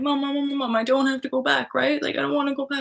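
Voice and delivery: high, anxious begging; pleading to mom. Retold or imitated. Calling her mother for reassurance, high anxious plea. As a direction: beg for reassurance like a frightened child. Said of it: "being like".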